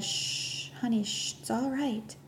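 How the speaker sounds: soft, hushed comfort; calm a wailing child gently; soothing hush. Acted. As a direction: nurse hushing a grieving girl. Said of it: "a nurse came over and said".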